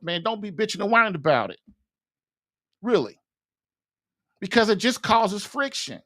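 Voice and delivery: ordinary talk, nothing marked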